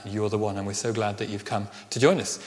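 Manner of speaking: ordinary talk, nothing marked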